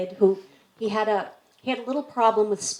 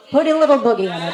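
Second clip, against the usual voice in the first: playful punchline; 3 semitones higher, 6 dB louder, faster than usual. Acted. Punchline of a kids' joke.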